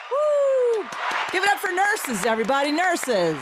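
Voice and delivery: high, loud whooping cheer; applause cheer. Pretend. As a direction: cheer like a crowd going wild. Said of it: "I'm gonna do the applause".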